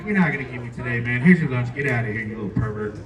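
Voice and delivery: low, muted brush-off; gruff dismissal. Retold or imitated. Lunch lady shooing a cheeky kid, acted low grumble. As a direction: send the kid away under your breath, low.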